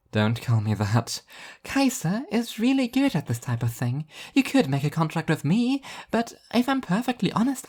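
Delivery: high, airy and cheerful; bubbly angel voice. Acted. Angel Arbitrage recommending Satan to Martin, high and bubbly. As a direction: gush like an eager tour guide angel.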